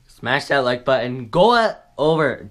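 ordinary talk

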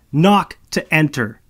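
loud, slow, knock stressed word by word; slow yelled repeat. Acted. Malo repeats the rule after Marcus, slow and loud. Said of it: "I yell after him".

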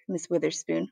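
plain reading voice

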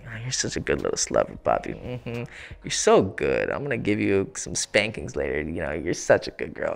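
low, fast demonic whisper; mischievous demonic voice. Shown as a demonstration. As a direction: purr it wickedly in her ear. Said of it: "this mischievous, demonic voice".

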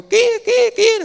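very high, loud repeated squawks; duck call imitation. Preacher mimics ducks paddling past the offering, high squawking calls. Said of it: "vịt nó lội ngay mà kêu".

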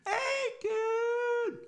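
very high drawn-out holler; acted family yell, sky-high. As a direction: call out the name in a high, stretched cry. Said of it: "everybody started yelling".

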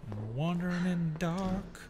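soft, hushed creeping commentary. Real, not acted. Creeping through dark game area.

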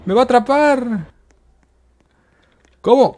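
alarmed cry. Real, not acted. Player about to be caught, alarmed cry. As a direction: cry out as the chaser closes in. Being chased in the game.

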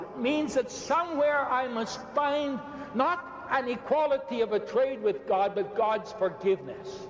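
normal talking voice

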